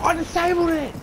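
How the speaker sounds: high excited exclaim; panicking gamer. Real, not acted. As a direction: shout it triumphantly. Gamer celebrates after plain take.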